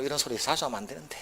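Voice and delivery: normal talking voice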